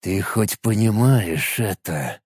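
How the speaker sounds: low, quiet and slow through clenched teeth; through gritted teeth. Acted. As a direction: grind the words through a locked jaw. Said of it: "процедил сквозь сжатые зубы".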